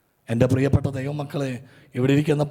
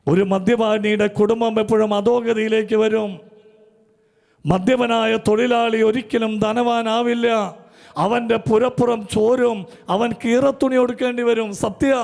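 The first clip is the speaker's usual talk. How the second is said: raised, slow preaching warning; 8 semitones higher, 6 dB louder than usual. Acted. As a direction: lift the voice and hammer the warning home. (ഉയർന്ന സ്വരത്തിൽ പ്രസംഗം)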